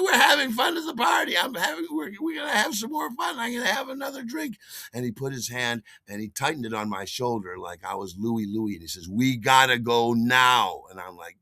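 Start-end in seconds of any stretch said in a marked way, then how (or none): 0.0-4.6 overexcited reveller refusing to leave; acted drunken protest. Drunk protests leaving party. 9.1-10.8 slow, loud, weighted order; stern friend ending the night; firm insistence. Retold or imitated. Friend tightens grip, insists.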